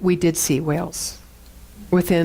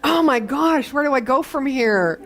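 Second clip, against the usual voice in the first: ordinary talk, nothing marked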